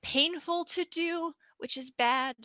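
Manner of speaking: pushed up high, mock strained voice; painful character voice. Shown as a demonstration. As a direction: squeeze it up high as if it hurts. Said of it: "you chose a voice that's, like, painful".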